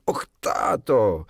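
loud, drawn-out exclamation; crying out. A narrator's reading. Wendy protests to her dad, crying out. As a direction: cry out in dismay, stretching the words. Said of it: "krzyknęła Wendy".

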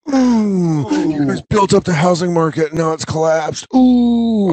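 high, far-off mocking coo over the losers; gloating mock sympathy. Server gloating at ruined bankers, high mocking coo. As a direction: gloat in a high sing-song. Mocking the crashed stock market guys.

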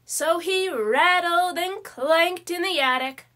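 ordinary delivery

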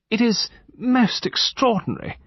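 high-pitched plaintive wail; distressed lady's high wail. A narrator's reading. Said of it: "wailed Lady Chevenix Gore".

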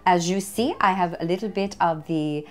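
playful put-on French accent. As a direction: present the dish like a mock French host. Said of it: "I don't know why I'm talking French".

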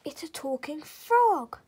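loud astonished gasp of words. A narrator's reading. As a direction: blurt it out in wide-eyed amazement. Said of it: "Kirsty gasped with her eyes wide".

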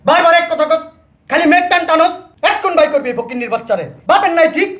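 high-pitched, contemptuous railing; angry tirade. Acted. Husband's quarrel about throwing the girl out, high angry insults.

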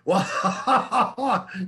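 a big amused laugh; hearty laugh. Acted. Father laughing at his son's trick, big laugh.

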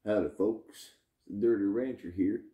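a light put-on Southern rancher accent; lightly voiced Southern ranch hand accent. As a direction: greet the folks as a hammed-up Southern cowboy. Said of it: "Don't mean to talk with such an accent".

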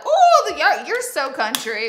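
high, loud teasing squeal, then drawn-out words; mock call-out. Imitating family calling out her country talk, mock call-out. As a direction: imitate teasers with a hammed-up high whoop. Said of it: "they'll call me out".

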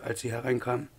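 usual speaking voice

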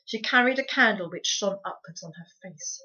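ordinary delivery

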